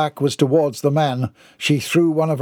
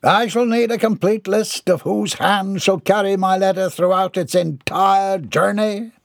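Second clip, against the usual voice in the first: high-pitched, self-important letter sender; pompous Mortimer; 6 semitones higher than usual. A narrator's reading. Mortimer orders the postman about, pompous. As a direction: insist grandly, drawing out the end.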